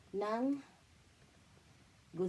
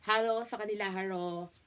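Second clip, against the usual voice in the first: usual speaking voice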